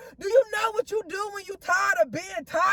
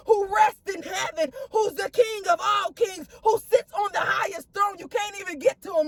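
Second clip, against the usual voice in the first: usual speaking voice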